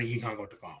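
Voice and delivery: usual speaking voice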